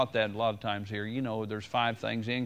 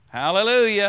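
loud, high hallelujah; shouted hallelujah; 6 semitones higher, 9 dB louder than usual. Real, not acted. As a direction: cry it out to the church.

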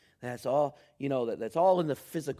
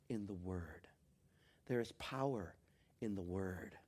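much softer, near-whispered emphasis; hushed reverence; 6 semitones lower, 10 dB quieter than usual. Real, not acted. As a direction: drop to a reverent hush.

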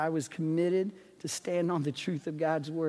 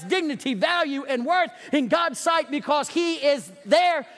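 raised, ringing pulpit voice; preaching at full force; 7 semitones higher, 5 dB louder than usual. Acted. Pastor rallying the congregation against prejudice, preaching loud.

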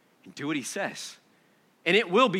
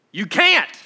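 sharp emphatic no; 5 semitones higher, 7 dB louder than usual. Real, not acted. Preacher's sudden emphatic rebuke.

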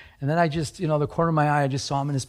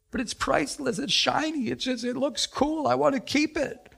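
put-on high, whiny-child style protest; acted high-pitched clinging; childlike pleading; 11 semitones higher than usual. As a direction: plead to keep a treasured toy. Acting out clinging to penny.

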